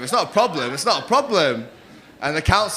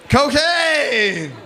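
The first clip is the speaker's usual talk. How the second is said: high, long drawn-out holler; imitation rowdy heckle, stretched out; mimicked crowd shout; 6 semitones higher than usual. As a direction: yell it gleefully from the crowd. Acting out shouted crowd answer.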